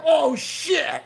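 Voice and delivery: sudden high yelp of alarm; startled yelp. Retold or imitated. Kid yelping as he falls on his ass. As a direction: yelp it out as you wipe out. Kid crashes doing flying move.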